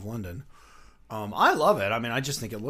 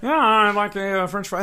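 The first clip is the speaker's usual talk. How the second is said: Muppet ordering voice; 11 semitones higher, 16 dB louder than usual. Retold or imitated. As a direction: order fries like a dopey Muppet. Muppet ordering at drive-thru.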